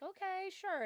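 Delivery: high-pitched, acted-out giving in; reluctant agreement. Student going along with a pushy stranger, agreeably giving in. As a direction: voice lifted into a polite yes.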